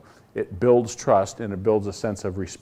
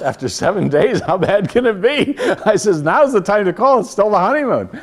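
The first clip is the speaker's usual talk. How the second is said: louder, higher, laughing through the words; laughing disbelief; 8 semitones higher, 8 dB louder than usual. Retold or imitated. Speaker retelling his reply to the introvert owner, laughing. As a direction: laugh at how silly the refusal is.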